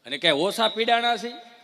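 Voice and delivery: usual speaking voice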